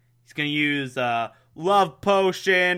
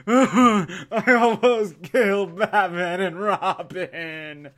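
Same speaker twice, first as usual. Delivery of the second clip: slow, laughing mock lament; laughing fake despair; mock anguish; 3 semitones higher, much slower than usual. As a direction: wail it through a laugh, slowly. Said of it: "crying inside".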